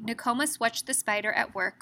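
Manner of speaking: plain reading voice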